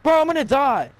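high, loud panicked cry; panicking gamer. Real, not acted. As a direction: yelp it as if about to die. Getting shot.

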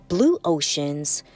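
usual speaking voice

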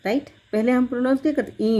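normal talking voice

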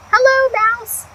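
high greeting as Duck; cheerful duck greeting. Acted. Duck greeting Mouse, high and cheerful. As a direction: pipe up a bright hello.

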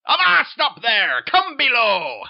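loud, harsh pirate-captain roar; bellowing captain. Acted. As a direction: bark orders like a tyrant at sea. Captain calls workmen down.

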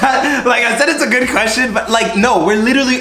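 giggling through the words, pitch way up; laughing while talking, high and giddy. Real, not acted. Laughing answer about free time.

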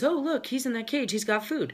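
high-pitched, playful spotting of food; storyteller's excited little crab; eager crab. Acted. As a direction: pipe up like an intrigued onlooker. Voicing a curious crab in story.

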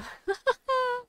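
sudden high-pitched laugh; high giggle. Real, not acted. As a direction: squeal with delight. Gleeful laugh at combo.

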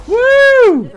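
loud high victory whoop; excited whoop. Real, not acted. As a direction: cheer the win. Celebrating a kill.

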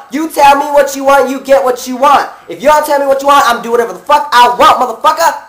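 acted-out indignant lecture; heated telling-off.

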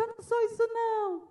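high-pitched imitation of a child; protesting child. Child answering the teacher back, mock upset protest. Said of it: "repetindo o que uma criança disse".

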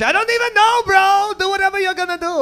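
put-on very high, loud squeaky Chinese accent; mock Chinese accent. Driver giving up on the cop, very high mock Chinese accent. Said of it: "then they turned Chinese".

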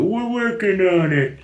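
mock catchphrase. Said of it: "we used to like to walk around and go".

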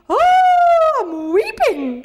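high, loud wail, drawn out slowly; mock weeping. Shown as a demonstration. Teacher shows class how to imagine Weipa, mock weeping. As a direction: wail it long, hamming up the tears. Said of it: "I don't just go".

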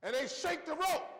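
ordinary talk

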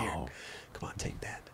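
barely audible whisper into the mic. Real, not acted. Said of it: "I'm whispering in the mic".

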